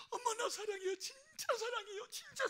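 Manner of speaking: high, loud tearful insistence, easing at the end; crying child. Retold or imitated. Little son telling dying mother his love, crying. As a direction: sob out the love like a small boy.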